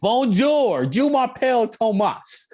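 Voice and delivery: high, drawn-out mock French delivery; demonstrated French. Shown as a demonstration. Speaker showing all his French, exaggerated French accent.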